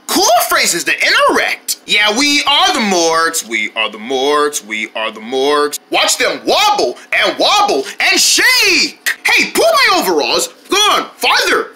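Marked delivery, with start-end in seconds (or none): 1.9-5.8 low toy voice. Acted. Toy's catchphrase.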